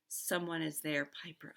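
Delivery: usual speaking voice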